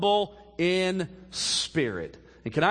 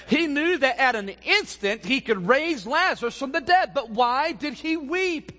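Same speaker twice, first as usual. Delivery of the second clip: full-force preaching; 7 semitones higher, 4 dB louder than usual. Acted. Pastor stirs the church about Lazarus, preaching at full force. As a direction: preach it higher and louder, building.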